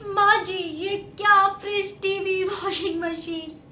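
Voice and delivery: loud, high, furious tirade; angry outburst. Acted. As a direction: hurl the dowry back at her mother. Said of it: "ऐसे गुस्सा करती है". (गुस्से में ऊँची आवाज़ में फटकार)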